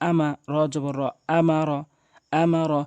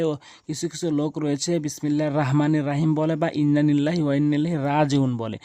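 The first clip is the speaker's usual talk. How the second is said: normal talking voice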